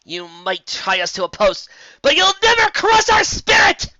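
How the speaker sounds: building to a high, loud roar. A narrator's reading. Lyra roaring at the Kelpies, building to a high defiant roar. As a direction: roar your defiance at them. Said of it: "roared Lyra".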